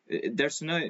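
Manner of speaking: ordinary talk, nothing marked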